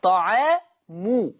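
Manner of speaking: طعام drawn out, final م with u; slow recited word. Shown as a demonstration. Teacher demonstrating Hafs reading of طعام, slowly. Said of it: "ورفع الميم".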